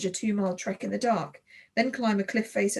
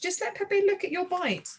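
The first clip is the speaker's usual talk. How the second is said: begging; 9 semitones higher than usual. A narrator's reading. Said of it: "I begged".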